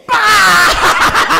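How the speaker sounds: big shouted laughing bah; loud laughing burst. Shown as a demonstration. Teacher releases held breath with the group, laughing out loud. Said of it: "And hold it".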